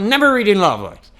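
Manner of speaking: mock reflex yell, high and loud; playful loud exclamation in English; automatic outburst. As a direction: blurt it out as if your foot were stepped on. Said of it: "Se me pisar no pé nessa hora, eu falo".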